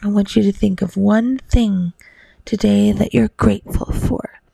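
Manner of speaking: ordinary delivery, nothing marked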